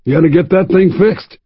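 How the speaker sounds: put-on high, gleeful old man; delighted old man. Old man at the bar inspecting the nose, high gleeful. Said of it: "his voice high with delight".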